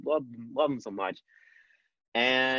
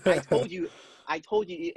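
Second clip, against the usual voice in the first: high, louder retold celebration; triumphant; 7 semitones higher, 4 dB louder than usual. Friend celebrates his reelection, excited I-told-you-so. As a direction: crow it like a hyped-up friend.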